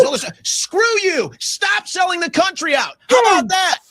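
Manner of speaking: high, loud "how about that" taunts; angry shout. Real, not acted. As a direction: yell each demand, pitch up. Shouting demands, repeated taunt.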